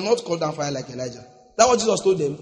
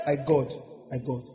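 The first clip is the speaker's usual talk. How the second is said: normal talking voice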